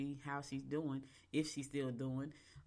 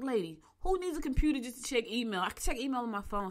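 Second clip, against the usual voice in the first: louder, higher scoffing comeback; incredulous retort; 7 semitones higher, 6 dB louder than usual. Retold or imitated. Talking back to the saleslady, loud scoffing retort. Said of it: "I was like".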